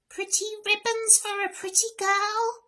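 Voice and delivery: witchy peddler woman; croaking old hag. Acted. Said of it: "she croaked".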